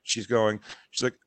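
ordinary talk

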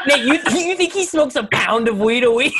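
high, loud laughing disbelief. Real, not acted. Laughing at Nate's guess.

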